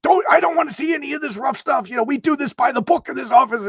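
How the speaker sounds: high, hammed-up scolding; mock yelling boss. Commissioner putting on a show for the visitor, mock yell. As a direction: fake a strict boss tirade. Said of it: "and yells at him".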